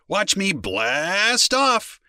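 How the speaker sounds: high, stretched-out blast-off; playful slow countdown-style launch cry; rocket launch excitement. A narrator's reading. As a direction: draw out BLAST like a rocket lifting. Boy plays rocket launch.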